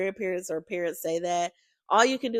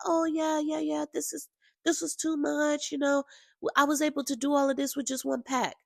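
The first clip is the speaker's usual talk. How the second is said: imitated hairstylist; 5 semitones higher than usual. Retelling the braider's reply to viewers, imitated in a higher voice. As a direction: mimic her reply, pitched up and light.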